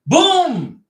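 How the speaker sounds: a sudden high, loud boom; explosive boom sound effect. Shown as a demonstration. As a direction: blast out a boom to show the punch. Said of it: "express the A like".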